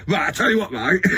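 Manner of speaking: high laughing mock tough-guy voice; a touch of a laddish threat; aggressive alter ego. Shown as a demonstration. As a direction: switch to a lightly hammed-up bloke picking a fight. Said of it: "Two seconds later".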